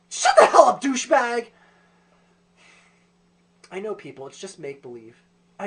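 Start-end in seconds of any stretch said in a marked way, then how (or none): 0.1-1.5 very loud, high mock outburst; angry shout. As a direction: shout the tattletale down.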